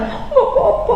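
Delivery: high unvoiced whiny reply; mock sulky wife. Husband asks, wife pouts back, mock sulky. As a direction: mimic a pouting wife brushing it off.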